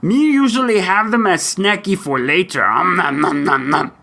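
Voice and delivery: high puppet monster ending in munching; Cookie Monster. Pretend. Host answers about crumbs in fur, Cookie Monster impersonation. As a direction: monster voice, gobbling noises to finish. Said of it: "Cookie Monster".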